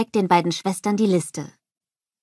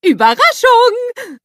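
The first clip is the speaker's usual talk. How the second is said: very high, loud, drawn-out holler; excited shout; 11 dB louder, slower than usual. A narrator's reading. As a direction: shout the surprise, stretching it out high. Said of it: "ruft Olaf".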